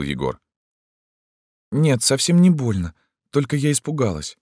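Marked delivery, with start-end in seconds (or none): 1.7-4.4 put-on high, girlish voice; small high-voiced reply; frightened girl, reassuring. A narrator's reading.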